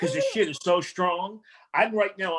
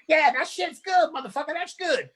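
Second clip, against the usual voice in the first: a lightly voiced street heckler, higher; heckling homeless man; 10 semitones higher than usual. Homeless man heckling the comic from the stones, higher mock voice.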